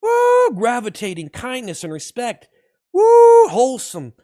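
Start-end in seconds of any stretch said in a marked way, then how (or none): 0.0-0.5 very high, loud woo; explosive hype whoop; loud whooping cheer. Real, not acted. Cheering the stream. 2.9-3.5 very high, loud woo; explosive hype whoop; loud whooping cheer. Real, not acted. Cheering the stream.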